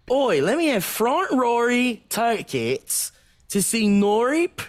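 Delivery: mock Australian. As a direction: ask for tickets like a broad Aussie. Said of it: "our impression of an Australian".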